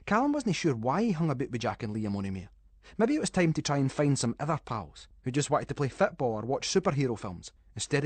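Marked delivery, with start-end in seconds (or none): none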